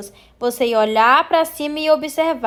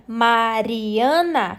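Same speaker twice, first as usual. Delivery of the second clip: Mariana slowly split into Ma-ri-a-na; syllable by syllable; 3 semitones lower, much slower than usual. Shown as a demonstration. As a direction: break Mariana into drawn-out syllables. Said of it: "Separar os pedacinhos da palavra". (Mariana sílaba por sílaba, bem devagar)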